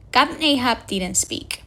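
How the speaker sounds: ordinary delivery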